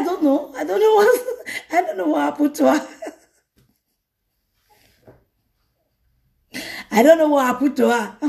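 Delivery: laughing through feigned innocence; mock innocent, laughing. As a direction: giggle while pretending not to know. Speaker pretends innocence, laughing.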